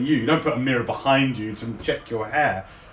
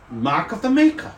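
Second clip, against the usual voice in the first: lightly voiced artificer; 8 semitones higher than usual. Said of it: "He says".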